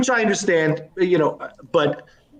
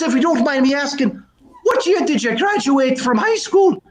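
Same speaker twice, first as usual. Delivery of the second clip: higher-pitched joke Irishman; Irish brogue; 7 semitones higher than usual. Acted. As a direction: ask politely in an Irish brogue. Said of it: "a bit of a brogue".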